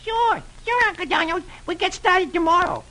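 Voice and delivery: very high, squeaky cartoon kids; tiny high-pitched cheerful character voice; cheerful nephews. A narrator's reading. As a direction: chirp it like eager little ducklings. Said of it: "his nephews say cheerfully".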